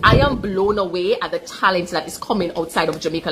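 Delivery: usual speaking voice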